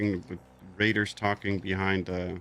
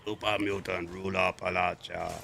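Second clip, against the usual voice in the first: super mutant voice. Pretend. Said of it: "I can mimic a super mutant voice perfectly".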